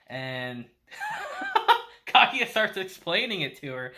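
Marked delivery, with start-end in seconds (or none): 0.9-2.0 high squealing laugh; burst of laughter. Real, not acted. Vlogger laughing at the anime kiss reveal, squealing laugh. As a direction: crack up in delight.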